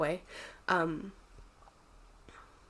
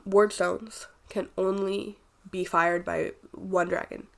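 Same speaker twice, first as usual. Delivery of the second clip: normal talking voice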